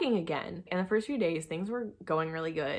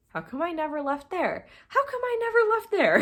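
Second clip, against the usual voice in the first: exasperated at herself; 11 semitones higher than usual. Retold or imitated. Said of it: "I'm like".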